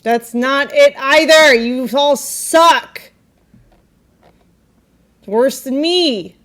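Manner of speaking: loud, slow exasperation; calls them all bad, loudly; loud complaint. Real, not acted. Judging bad chuff attempts.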